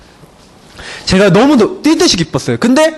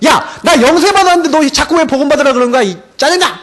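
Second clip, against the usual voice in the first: mock angry outburst, higher pitch; imitating annoyed friend; 6 semitones higher than usual. Friend fed up with being told the gospel, mock angry. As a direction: act out a friend snapping back, voice raised in pitch. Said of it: "저한테 화를 내는 거야".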